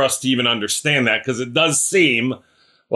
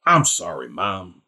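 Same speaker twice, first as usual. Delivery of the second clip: much lower and softer, a sheepish mumbled sorry; sheepish apology; 6 semitones lower, 4 dB quieter than usual. Retold or imitated. Imagined boy Jesus apologizing to his parents, low sheepish mumble. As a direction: hang your head like a caught boy, low and quiet. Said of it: "would have said".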